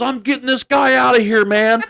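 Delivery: high, fired-up boast; the rookie pumped up, boasting high. Retold or imitated. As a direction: play the hero in his head. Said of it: "He goes".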